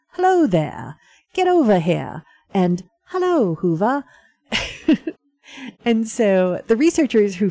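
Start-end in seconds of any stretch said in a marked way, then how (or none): 0.1-2.2 imitated heavy Maine accent, a bit louder; talking seal with a Maine accent. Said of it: "in a heavy Maine accent". 3.1-4.0 higher-pitched mock Maine accent, dropped r; talking seal with a Maine accent. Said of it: "in a heavy Maine accent".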